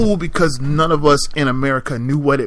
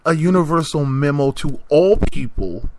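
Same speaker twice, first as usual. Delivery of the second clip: normal talking voice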